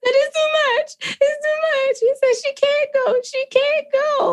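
high, wailing mock sob; high crying voice. Retelling the movie scene to her cohost, a high wailing mock sob. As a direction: wail it like you are breaking down.

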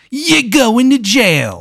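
high, drawn-out mock-official cry; gleeful arrest announcement. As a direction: sing out the arrest, stretching it high. Acted FBI agent announcing arrest.

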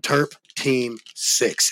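each word drawn out slowly; slow, deliberate. Real, not acted. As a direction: spell out the name word by word. Spells out name on the hat.

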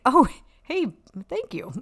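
high, squeaky mock-grateful "Oh, hey"; mock surprised thanks. Mother greeting the rival coach, high mock thanks. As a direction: pitch way up in fake cheer. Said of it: "I'm like".

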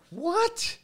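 high incredulous what; shocked what. Retold or imitated. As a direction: squeak out a stunned what. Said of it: "I'm like".

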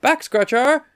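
high-pitched goofy waiter call; hammed-up, loud, high imitation of a waiter; wacky waiter. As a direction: sing out the gag to the kitchen. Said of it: "And then he goes".

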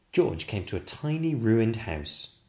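ordinary delivery, nothing marked

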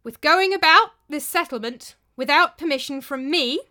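loud, high proclamation, pitch jumping; loud, cracking voice. A narrator's reading. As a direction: call it out with the voice cracking on some words. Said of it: "His voice cracked as he spoke".

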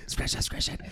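very soft, urgent whisper; imitated whisper. Band member changing the plan mid-show, imitated whisper. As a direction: whisper a hasty retraction. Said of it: "then I'd be like".

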